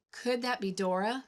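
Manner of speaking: plain reading voice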